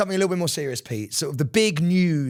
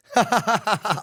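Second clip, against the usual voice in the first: higher staged burst of laughter; put-on laugh; 6 semitones higher than usual. Shown as a demonstration. Re-recording a laugh for a voice note, put-on laugh. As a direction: fake a big laugh for a recording. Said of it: "when you, like, go".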